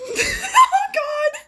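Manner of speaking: high-pitched cry through laughter; laughing exclamation. Real, not acted. Reader reacting to a brutal part, laughing exclamation. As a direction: burst out laughing on the words.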